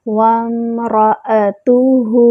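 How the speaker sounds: وَامْرَأَتُهُ with waw joined straight to meem; tajweed demo. Shown as a demonstration. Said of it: "واو کو direct میم سے ملائیں گے".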